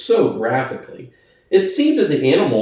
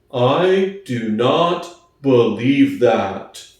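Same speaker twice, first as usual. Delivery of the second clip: slow Boxer; 2 semitones lower, much slower than usual. Acted. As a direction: think hard and speak slowly. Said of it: "he said finally".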